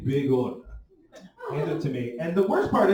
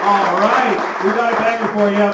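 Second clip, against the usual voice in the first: louder, faster, higher host hype; announcer hype; 8 semitones higher, 15 dB louder than usual. Acted. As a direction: rev up the crowd like an MC.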